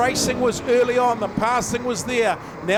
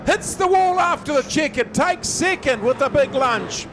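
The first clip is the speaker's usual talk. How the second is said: motorsport commentator; 3 semitones higher than usual. Real, not acted. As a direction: shout the last lap home. Finish line live call.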